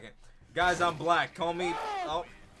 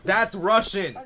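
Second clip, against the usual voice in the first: loud, raised-pitch triumphant insistence; insistent shouting; 5 semitones higher, 11 dB louder, slower than usual. Real, not acted. Gamer insisting his challenge counts, loud defiant shouting. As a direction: shout it down at the doubters.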